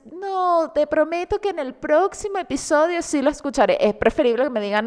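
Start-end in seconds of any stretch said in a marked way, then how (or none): 0.1-3.8 mock evasive friend. Acted friend dodging podcast invite.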